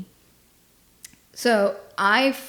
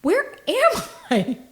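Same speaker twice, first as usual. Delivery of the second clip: much higher, slow and lost; dazed confusion; 11 semitones higher, slower than usual. Retold or imitated. Telling the cohost about her brain fog, dazed and lost. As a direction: drift off like you've woken somewhere strange. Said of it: "I'm like".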